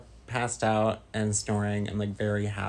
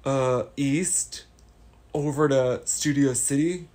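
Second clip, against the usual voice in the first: SNL California dude; 3 semitones higher, 6 dB louder than usual. Acted. Doing an SNL California bit for chat, valley-dude voice. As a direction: ham up the California surfer voice. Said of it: "I don't know the voice. I'm just trying to do, uh, more, uh, S N L vibes".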